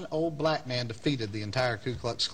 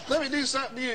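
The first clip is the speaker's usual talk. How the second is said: high-pitched reverend voice; imitated reverend; 10 semitones higher, faster than usual. Reverend offers to pray for his caller, high imitated voice.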